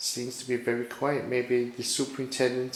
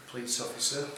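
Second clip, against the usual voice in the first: usual speaking voice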